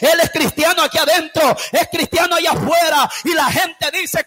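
loud, impassioned sermon delivery; fiery preaching. Acted. Pastor preaching hard to the church, impassioned shout. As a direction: preach it with fire to the congregation.

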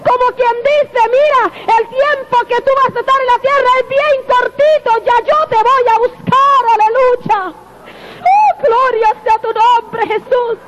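high-pitched rising preacher's exclamation; fervent preaching. Acted. Preacher to the brethren, fervent high-pitched praise. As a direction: lift the congregation with fervent praise.

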